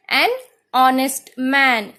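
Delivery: ऑनेस्ट slow, no h; silent h. Shown as a demonstration. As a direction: say ऑनेस्ट starting on the vowel. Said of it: "हम एच यहाँ पे pronounce नहीं करते". ("ऑनेस्ट" बिना एच के धीरे)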